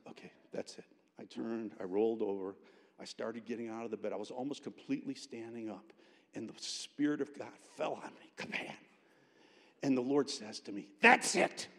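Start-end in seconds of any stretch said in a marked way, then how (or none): 0.1-0.8 muttered resolve. Retold or imitated. Retold inner resolve to hit roommate. 11.0-11.7 high, loud, abrupt burst; sudden exclamation. Retold or imitated. The Lord interrupting an angry student, sudden loud exclamation.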